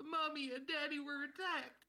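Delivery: put-on tiny, high, very quiet child voice; a small tearful child, barely audible; high soft whimpering; crying little girl. Game master plays crying little girl.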